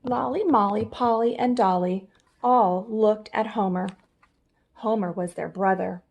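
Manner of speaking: ordinary delivery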